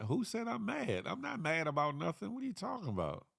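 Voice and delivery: high-pitched playful protest; mock indignant. Streamer denying being mad to a viewer, mock indignant. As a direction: act offended at the very suggestion.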